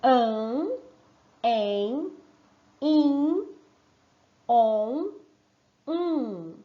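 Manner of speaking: each nasal sound held and sounded out one by one; nasal sounds demo. Shown as a demonstration. Teacher modeling the sounds to her pupils, clearly sounded out. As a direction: model the sounds an, em, in, on, um slowly for children. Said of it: "trabalhando os sonzinhos".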